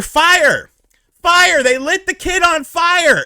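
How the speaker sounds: high, loud alarm as the fire news breaks; alarmed cry. Retold or imitated. Telling friends the kid was lit on fire, loud alarmed cry.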